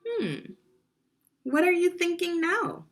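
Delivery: ordinary delivery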